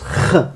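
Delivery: "ch" as a long throaty rasp; German final ch. Shown as a demonstration. Teacher confirms the Munich ending, "ch" as guttural kh. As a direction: scrape "ch" at the back of the throat. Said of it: "se prononce".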